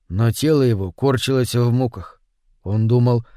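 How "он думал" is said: usual speaking voice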